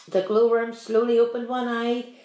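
ordinary delivery, nothing marked

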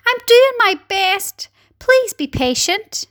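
high, plaintive glowworm voice; poor glowworm. A narrator's reading. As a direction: plead in a high little voice. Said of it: "answered the poor glowworm".

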